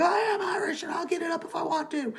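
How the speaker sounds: hammed-up indignant Irish hero yelling back; defiant outburst. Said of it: "He's like".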